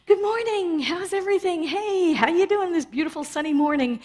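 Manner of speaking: high, bright tourist cheer; overly cheery greeting. Retold or imitated. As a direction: greet the cashier with mock sunny delight. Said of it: "and they went".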